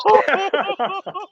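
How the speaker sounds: high burst of laughter. Real, not acted. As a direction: crack up laughing. Co-host laughs again.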